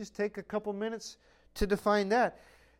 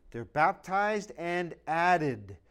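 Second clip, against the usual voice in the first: drawn out, weight on baptized; slow emphasis; much slower than usual. Acted. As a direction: let baptized sink in slowly. Preacher slowing key phrase.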